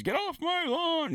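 put-on high, cranky old geezer yelling at kids; mock old man hollering at the neighborhood kids; grumpy old man. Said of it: "You sound like an old man telling the kids".

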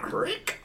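high whispered creak; door creak sound. Shown as a demonstration. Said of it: "That's the door opening there".

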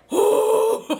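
high, loud burst of giggling; imitated guy's high laugh. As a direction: mimic a guy cracking up mid-class. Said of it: "but he'd be like".

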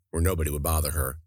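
ordinary delivery, nothing marked

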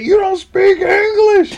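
high, loud, drawn-out mock shout; yelling customer. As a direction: act out an irate customer berating staff. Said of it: "He's yelling".

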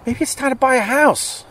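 high mock inner voice; a wry thought to himself upstairs.

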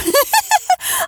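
very high mocking laugh; wild rabbit laughing. A narrator's reading. Wild rabbit laughs at the toy rabbit, very high. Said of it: "began to laugh".

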